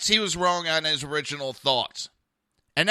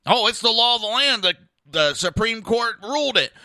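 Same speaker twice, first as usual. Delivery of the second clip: loud, raised-pitch mimicry of a know-it-all; mock insistence; 6 semitones higher, 7 dB louder than usual. Host parroting opponents on the Supreme Court, loud mock insistence. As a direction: mimic someone laying down the law.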